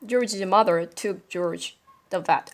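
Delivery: ordinary delivery, nothing marked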